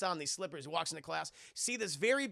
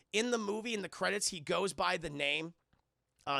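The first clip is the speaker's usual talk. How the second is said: usual speaking voice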